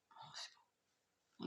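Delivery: slow, breathless whisper of wonder; real whisper, barely audible; awed whisper. Real, not acted. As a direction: hush it out in awe. Admiring the cards drawn.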